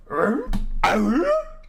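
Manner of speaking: high, startled gasp; comic sound of shock; astonished dog gasp. Acted. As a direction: gasp like a roomful of amazed dogs. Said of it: "all the dogs are like".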